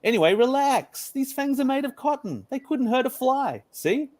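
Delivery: put-on a friendly toy lion reassuring the boy; pillow lion. High voice for the lion.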